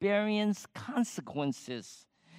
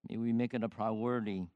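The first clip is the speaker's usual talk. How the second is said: low, soft, halting prayer; closing prayer; 5 semitones lower, 4 dB quieter than usual. Real, not acted. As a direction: pray quietly and humbly for the parents.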